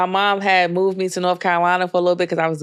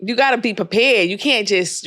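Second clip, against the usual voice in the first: normal talking voice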